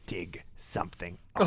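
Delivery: low, slow whispered order; whispered Murdoch line; menacing whisper. Retold or imitated. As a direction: breathe the order like a threat. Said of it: "He actually, at that time, said".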